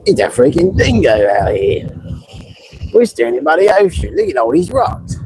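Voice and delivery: mock Australian tourist accent, playing it for laughs; bad Australian accent. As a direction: put on a jokey amateur Aussie voice. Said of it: "I can't really do an Australian accent".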